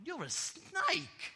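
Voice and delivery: high-pitched acted protest; indignant boy. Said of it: "the little Indian boy looks at it and says".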